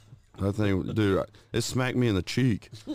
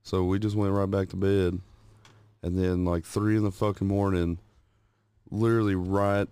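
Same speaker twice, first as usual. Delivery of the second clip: usual speaking voice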